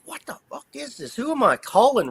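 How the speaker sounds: high-pitched baffled questioning; retold bewilderment. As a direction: ask it high, totally lost. Retold bewilderment at strange call.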